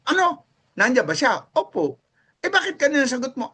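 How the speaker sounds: high, sharp wife demanding answers, sped up; suspicious wife on the phone. Retold or imitated. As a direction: play the wife catching the lie over the phone.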